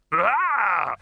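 sharp high yelp of alarm; sudden alarmed cry. Acted. Librarian missing the target mid-swing, alarmed yelp. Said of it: "This was the noise made as he missed".

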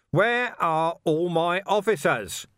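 high, drawn-out yell. A narrator's reading. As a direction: bellow it slowly, word by word. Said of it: "Vimes yelled".

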